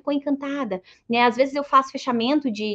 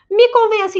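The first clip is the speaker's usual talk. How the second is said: teasing challenge; 8 semitones higher, 9 dB louder than usual. Retold or imitated. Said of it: "E eu mexo com ele e digo".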